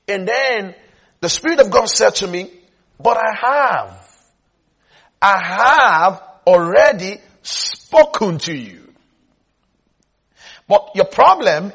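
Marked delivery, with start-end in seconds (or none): 3.0-8.9 slow, firm imitated reply; emphatic divine answer. Preacher voicing God's answer to him, slow and emphatic. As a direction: stretch the words out in firm correction.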